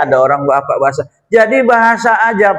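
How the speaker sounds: ordinary talk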